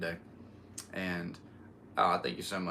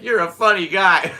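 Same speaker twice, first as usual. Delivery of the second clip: much louder, higher mock gangster line; mobster quote; 9 semitones higher, 13 dB louder than usual. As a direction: bellow it like a grinning tough guy. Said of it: "he's like".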